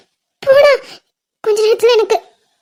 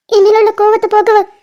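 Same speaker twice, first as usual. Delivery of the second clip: ordinary talk, nothing marked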